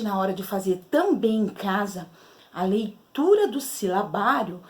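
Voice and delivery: ordinary talk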